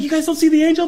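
high, loud disbelief; can't believe they miss it; incredulous. Retold or imitated. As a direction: friend to roommates, incredulous. Friend incredulous.

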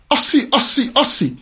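high-pitched mock shout at normal volume; retold shout. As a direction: imitate someone shouting out a chant, without real force. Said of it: "he shouted out".

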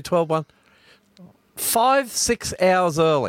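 rising disbelief, spelling it out slowly; incredulous counting. Real, not acted. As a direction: count the hours, amazed.

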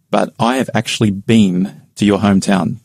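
usual speaking voice

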